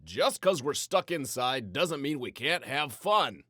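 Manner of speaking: announcing. A narrator's reading. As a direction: call the room to attention. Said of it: "Papa announced".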